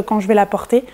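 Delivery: ordinary delivery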